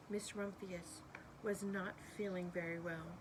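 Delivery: usual speaking voice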